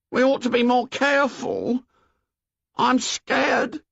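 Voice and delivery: high, worried schoolboy voice; anxious Piggy. Acted. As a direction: fret like a nervous boy watching the fire.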